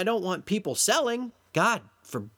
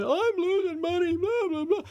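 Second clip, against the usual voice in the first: high, mocking whine; dismissive high mock whine of losing traders; whining subscriber; 9 semitones higher than usual. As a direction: parody the complainers in a high whine. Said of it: "people that show up on the site".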